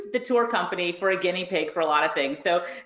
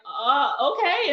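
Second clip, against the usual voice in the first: caught off guard; 6 semitones higher, slower than usual. Retold or imitated. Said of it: "caught me off guard".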